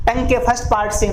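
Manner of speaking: ordinary delivery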